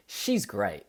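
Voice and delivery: ordinary delivery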